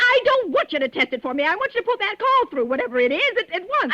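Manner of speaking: insist, raising your voice; indignant demand. Acted. Arguing with the operator.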